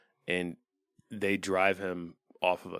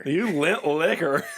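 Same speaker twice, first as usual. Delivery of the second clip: much higher and louder, laughing through it; laughing taunt; 9 semitones higher, 7 dB louder, slower than usual. Pretend. Joining in mocking the Frenchmen, slow laughing high taunt. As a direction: cackle the insult out slowly.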